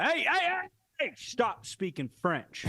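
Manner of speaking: hammed-up scold, voice jumping way up; mock yell. A tourist yelling at locals to stop, mock yell. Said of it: "going to Africa and going".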